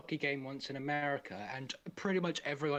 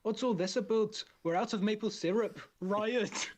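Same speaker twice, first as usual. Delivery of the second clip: a deliberately bad Canadian impression; bad Canadian accent; 5 semitones higher than usual. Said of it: "I can't do a Canadian accent".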